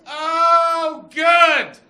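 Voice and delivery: very loud, high, drawn-out cry; overjoyed relief. Retold or imitated. High man learning the husband is real, loud relieved cry. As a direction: shout it with hammed-up relief.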